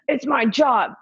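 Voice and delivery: high, loud imitated protest; raging man. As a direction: mimic him insisting indignantly. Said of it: "like, raging".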